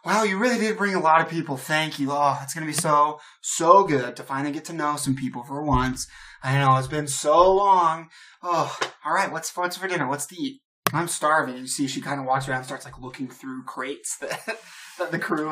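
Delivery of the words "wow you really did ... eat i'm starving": loud, excited chatter from a lonely islander; animated, emphatic enthusiasm; eager gushing. Acted. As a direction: pour out delight at finally having company. Lydia gushes over having company.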